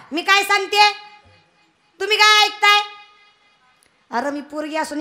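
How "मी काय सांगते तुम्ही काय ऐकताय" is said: higher and louder, calling for attention; loud demand. Acted. Preacher demanding the children's attention, loud. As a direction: make the kids listen with a raised voice.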